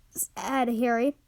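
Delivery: ordinary delivery, nothing marked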